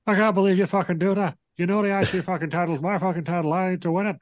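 high, hammed-up Irish whine imitating Sheamus; mock Irish rant. Retelling Sheamus ranting at Drew.

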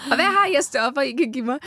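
hammed-up yelling for pain relief; mock scream. Mother-to-be imagining herself begging staff for drugs, mock scream. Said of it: "jeg skriger".

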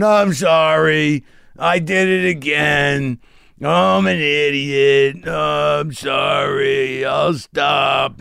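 put-on slow, drawn-out pleading wail of a drunk; sad apologetic drunk. Comic predicts the drunk husband's apology to his wife, sad and whiny. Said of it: "with the sad face on, going".

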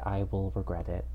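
ordinary talk, nothing marked

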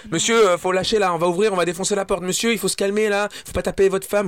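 high, urgent commands through a door; alarmed neighbours. Retold or imitated. Neighbours bang on the door to stop him, high and urgent. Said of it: "ça commence à frapper les voisins".

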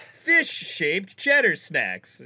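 slow, loud, deadpan label read; mock product label. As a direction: read it like a cheap knockoff box. Mocking off-brand label.

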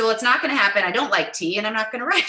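amused loud refusal; laughing refusal. Retold or imitated. Laughing refusal of the tea gift.

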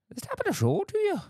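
fast, very high squeak; silly high voice. Acted. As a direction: announce it in a cartoon voice. Silly segment intro.

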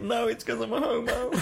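high-pitched, giggly comic reply; laughing retort. Retold or imitated. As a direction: blurt it out high, breaking into laughter.

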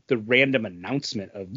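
usual speaking voice

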